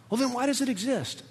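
high-pitched mock questioning; puzzled objection. Listener challenging preacher on why hell exists, puzzled objection. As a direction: pipe up as a baffled listener.

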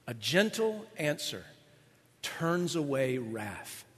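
soft, slow reading of the verse; scripture reading. Acted. Pastor reading Proverbs to congregation, soft and slow. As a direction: read the proverb gently.

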